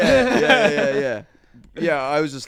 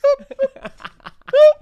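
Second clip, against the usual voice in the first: high squeaked mock sob; cartoonish burst of tears; comic crying; 16 dB louder than usual. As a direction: squeak out sudden silly sobs. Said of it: "I just I started crying".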